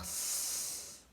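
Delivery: "s" as a long "sssss" hiss, not the letter name; "s" as a sustained unvoiced hiss; snake-like S sound. Shown as a demonstration. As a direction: hiss "s" like a little snake between the teeth. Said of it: "la lettre qui fait ce bruit".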